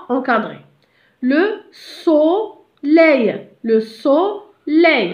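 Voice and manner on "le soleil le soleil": "soleil" very slowly, "so" held apart from "leil"; teacher sounding out "soleil" in slow, separate syllables; split into syllables. Shown as a demonstration. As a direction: stretch each syllable of "soleil". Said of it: "سمعنا so".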